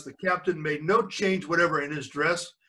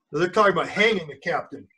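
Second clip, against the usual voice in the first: ordinary delivery, nothing marked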